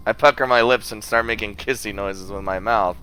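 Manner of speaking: ordinary talk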